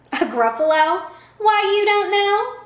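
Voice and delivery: high, loud put-on mouse voice; squeaky mouse, mock astonished; mock-amazed mouse. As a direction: act shocked the owl doesn't know. Mouse feigns shock.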